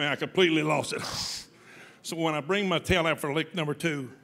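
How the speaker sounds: normal talking voice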